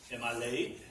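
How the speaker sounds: ordinary delivery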